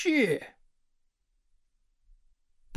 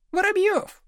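shouted name; 14 semitones higher than usual. A narrator's reading. Classmates calling false names to the teacher, high-pitched shout. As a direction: cry the surname out high. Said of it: "кричали Слушкину".

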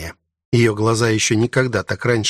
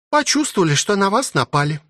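high-pitched maid voice, sighing; 9 semitones higher than usual. A narrator's reading. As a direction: explain with a sigh, pitched well up. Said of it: "вздохнула Лили".

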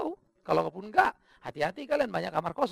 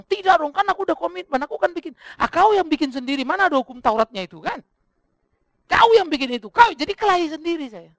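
high, loud self-argument; full-force preaching; 10 semitones higher, 14 dB louder than usual. Acted. Preacher wrestling with himself over the envelope, high loud tirade. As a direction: shout it as a heated quarrel with your own conscience. Acts both sides of inner quarrel.

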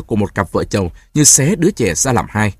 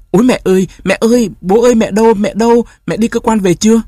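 giọng trẻ con cao vút, to, hoảng hốt; hét lên như bị đuổi trong mơ; bé gái thét gọi mẹ; 11 semitones higher, 4 dB louder than usual. Acted. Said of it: "thét rú lên như có ai đuổi đánh". (bé gái giật mình nửa đêm, thét gọi mẹ cao và to)

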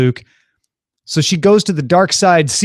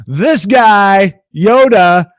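louder, higher announcing a surprise guest; a game-show introduction; excited reveal; 5 semitones higher, 6 dB louder, much slower than usual. Real, not acted. Announcing Yoda's surprise appearance.

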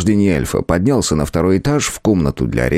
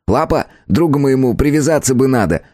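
lightly raised, cheeky elf voice; christmas elf; 6 semitones higher than usual. A narrator's reading. Elf character voice.

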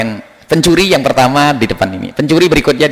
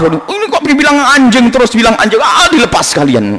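high-pitched exasperation, dropping at the end; indignant outburst that falls away in defeat; acted anger; 8 semitones higher than usual. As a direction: play the fed-up goat owner.

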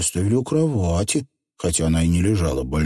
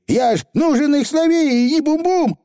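squeaky little Scottish pixie; high, rough tiny rascal; Feegle voice; 4 dB louder than usual. Acted.

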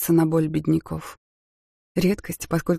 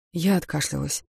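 usual speaking voice

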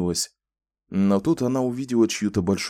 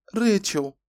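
put-on much higher, small child's voice; a timid child saying her name; little girl; 12 semitones higher than usual. A narrator's reading. Shy child says her name. (детский голос, высоко)

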